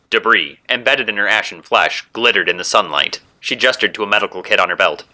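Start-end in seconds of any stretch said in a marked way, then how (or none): none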